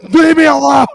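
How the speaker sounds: tickled teammate begging to be left alone, high and loud; mock whine. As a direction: squeal it like a tickled kid. Teammate whining when tickled.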